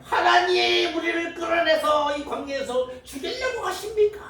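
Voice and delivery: very high, slow whining complaint; mock grumbling Israelites. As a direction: whine like the Israelites in the desert.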